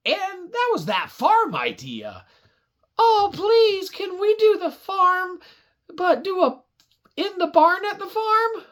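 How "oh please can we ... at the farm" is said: put-on high, eager begging kid; pleading child voice. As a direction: beg like an excited little kid. Kid begging for farm idea.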